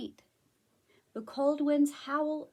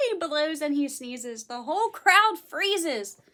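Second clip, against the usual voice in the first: dramatic; 3 semitones higher, 7 dB louder than usual. A narrator's reading. Dramatic read.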